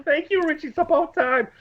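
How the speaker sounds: high shouted "Thank you, Richie"; mock fan yell, high; retold yell. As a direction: yell it over the crowd at last. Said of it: "I'm yelling".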